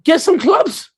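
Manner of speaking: high, sarcastic imitation of a dismissive boss; high sneering mock quote, ending slow. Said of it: "the woman that got you be like".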